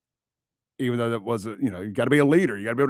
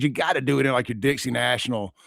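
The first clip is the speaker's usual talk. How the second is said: ordinary talk, nothing marked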